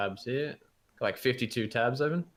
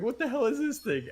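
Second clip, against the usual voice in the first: high, frantic puppy voice; panicked dog voice; 12 semitones higher than usual. Retold or imitated. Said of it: "panicking, going".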